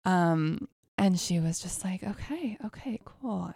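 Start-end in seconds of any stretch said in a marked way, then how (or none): 2.0-3.5 soft whispered okay; breathy hushed agreement. Retold or imitated. Kimmy whispering in sacred space.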